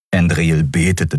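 ordinary delivery, nothing marked

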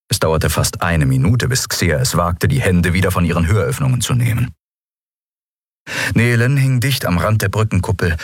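plain reading voice

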